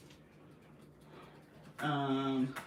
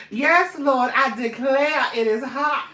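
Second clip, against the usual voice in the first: high, loud Southern belle drawl; fanning herself, Southern belle accent; 12 semitones higher, 9 dB louder, slower than usual. Pretend. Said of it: "you don't like my southern accent".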